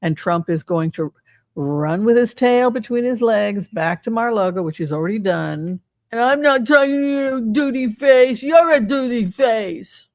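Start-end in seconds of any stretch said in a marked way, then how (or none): 6.1-10.1 high, playground-style mocking taunt; childish taunt. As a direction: sneer it like a kid in the schoolyard.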